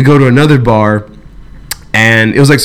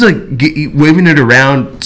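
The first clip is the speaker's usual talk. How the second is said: ordinary talk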